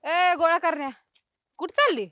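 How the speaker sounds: loud call after someone walking away; loud, demanding call across the field. Acted. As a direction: shout after them to stop. Calling after someone leaving.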